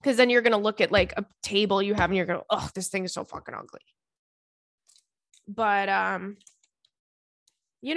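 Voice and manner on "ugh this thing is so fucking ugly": low-key disgusted grumble, quick and softer; mock disgust. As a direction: complain to yourself under your breath, fast. Imagined disgusted reaction to own table.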